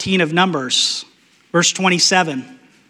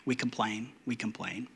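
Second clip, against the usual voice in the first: much softer, lower, trailing off; fading repeat; 7 semitones lower, 18 dB quieter than usual. Acted. Preacher admits we complain too, fading softly. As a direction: let we complain dwindle.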